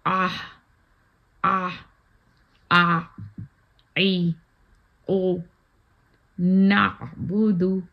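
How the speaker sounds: आ ई ओ with a squeezed mid-throat आइन, नाबुदु slow; ain drill. Shown as a demonstration. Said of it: "आइन की practice है ये".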